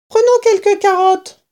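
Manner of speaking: little fox cub. A narrator's reading. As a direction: pipe up like the smallest cub. Smallest cub again.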